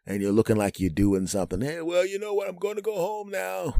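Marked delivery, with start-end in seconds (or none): 1.6-3.8 high put-on casual voice; mock-casual announcement. Husband telling listening hosts he's leaving, high put-on casualness. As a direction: feign nonchalance in a high sing-out.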